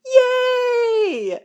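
high, loud mock cheer; excited cheer. Cheering for Mick burning the planes, gleeful shout. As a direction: whoop like a gleeful arsonist.

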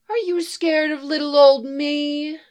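woman taunting the hesitant vigilante; teasing. A narrator's reading. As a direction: needle him playfully.

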